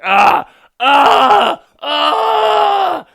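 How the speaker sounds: very loud, high imitated screams; screaming. Mocking a couple in a movie sex scene, screaming. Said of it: "They're literally just screaming".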